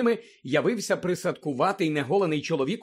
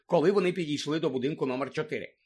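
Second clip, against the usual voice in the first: ordinary delivery, nothing marked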